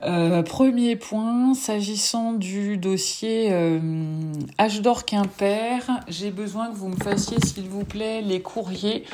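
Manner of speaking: slow, careful dictation; dictating. Real, not acted. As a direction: spell it out slowly for typing. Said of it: "la dictée".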